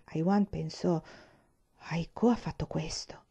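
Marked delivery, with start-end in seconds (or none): 1.9-3.2 hushed, voiceless realization; a private thought under breath; whispered thought. A narrator's reading. Brother silently realizing what Aiko did, whispered.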